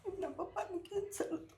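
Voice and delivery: high, choked-up crying voice; tearful. Real, not acted. Widow telling interviewer of his cancer, crying. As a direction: get the words out through real tears. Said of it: "કેમ રડો છો બેન?".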